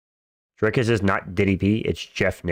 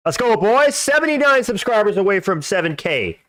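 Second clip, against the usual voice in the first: frat bro; 11 semitones higher than usual. Real, not acted. Streamer hyping subscriber goal.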